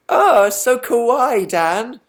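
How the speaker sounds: high, slow, drawn-out fangirl coo; mock cute gushing. Vlogger posing a heart for viewers, mock cute gushing. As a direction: gush over something adorable, pitched right up.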